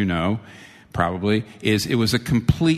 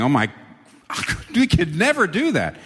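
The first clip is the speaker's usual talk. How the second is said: aghast disciples; 7 semitones higher than usual. Acted. Disciples' dismayed reaction voiced.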